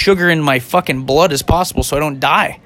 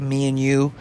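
ordinary talk

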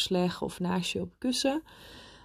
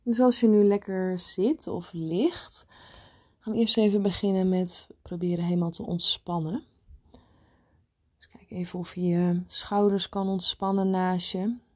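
guided meditation. Acted. Leads a meditation.

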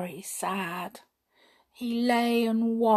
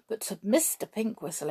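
ordinary delivery, nothing marked

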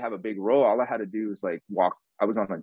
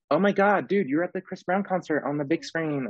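higher, gushing Oh my God; excited friends; 8 semitones higher than usual. Retold or imitated. Friends texting him about the concert screen, gushing and higher. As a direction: squeal the news like a thrilled friend.

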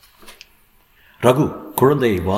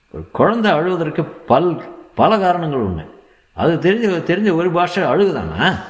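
high-pitched sarcastic lecture; stern scolding doctor; 8 semitones higher than usual. A narrator's reading. Doctor lecturing mother on why babies cry, stern high rebuke. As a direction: drive each point home, high and scolding.

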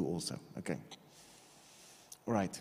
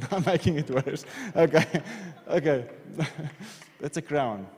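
laughing; 4 semitones higher, 4 dB louder than usual. Real, not acted. Laughs at own drawing.

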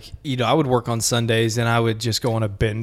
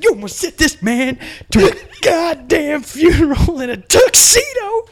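high, loud mock rage; mock furious yell; 5 dB louder than usual. Furious boss scolding the clerk, high and loud. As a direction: shriek it out in mock fury.

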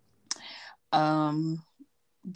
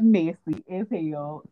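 slow, stretched messy as hell; slow mock verdict on a messy man; drawn out; 3 dB louder, much slower than usual. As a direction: drag out each word. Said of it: "I was like".

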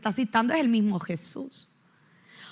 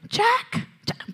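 Rose calling Jack; 9 semitones higher than usual. Retold or imitated. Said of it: "ella le dice".

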